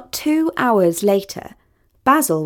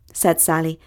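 usual speaking voice

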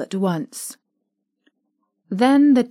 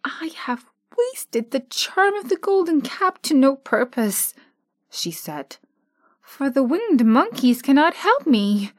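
disappointed; 5 semitones higher than usual. Acted. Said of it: "ready to cry with disappointment".

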